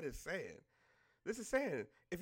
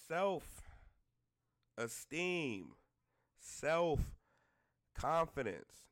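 very slow, lower, word-by-word delivery; pointedly drawn out in a lowered voice; slow and deliberate; 6 semitones lower, much slower than usual. Real, not acted. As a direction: space out each word to drive the point home. Spells out the lesson pointedly.

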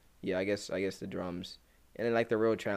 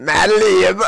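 loud high announcer-style drop; hype name drop; 9 semitones higher, 17 dB louder than usual. Acted. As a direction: call the name like a producer tag. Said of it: "the way you said it".

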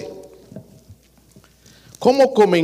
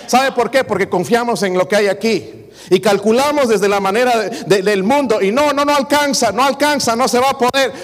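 voz de púlpito, alta y enfática; predicando con fuerza; 5 semitones higher than usual. Acted. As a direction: proclama cada frase a la congregación. Preaches at full force. (predicador exhortando a los hermanos con fuerza)

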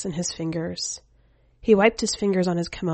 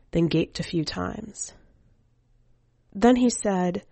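ordinary delivery, nothing marked

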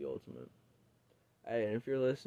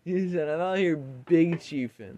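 excited; 5 semitones higher, 8 dB louder, slower than usual. Real, not acted. Excited retelling, loud.

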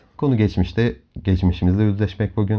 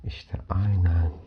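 soft spa whisper; 3 semitones lower, 4 dB quieter than usual. Acted. Leads a guided relaxation. (yumuşak rehberli meditasyon sesi)

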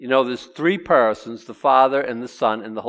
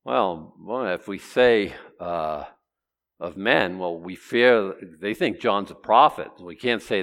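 hesitant deliberation; 6 semitones lower than usual. Acted. Acts out Pharisees weighing a cagey answer.

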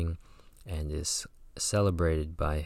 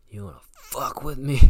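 higher, hammed-up street-style threat; mock tough challenge; 7 semitones higher than usual. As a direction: playfully act out a cocky dare. Mocks the shaman's threat, laughing.

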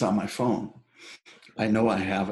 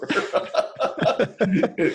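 high laugh; 8 semitones higher than usual. Real, not acted. Laughing at the outtakes.

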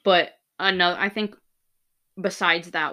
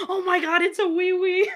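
high, loud thrilled exclamation; excited squeal; 9 semitones higher, 6 dB louder than usual. Retold or imitated. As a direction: squeal it with delight. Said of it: "re-enacting excitement".

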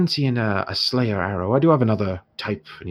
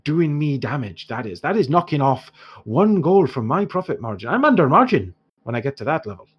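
put-on haggling dwarf merchant in an Irish accent; dwarf shopkeeper in an Irish accent haggling with the heroes; 6 semitones higher, 4 dB louder than usual. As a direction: bargain in a lilting Irish dwarf voice.

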